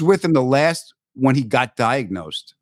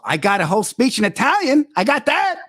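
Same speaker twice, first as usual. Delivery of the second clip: proud boast; 11 semitones higher than usual. Retold or imitated.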